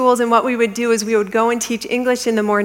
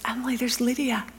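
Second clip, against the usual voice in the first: whisper; 2 semitones higher, 8 dB quieter than usual. Retold or imitated. Husband spots Lydia nearby.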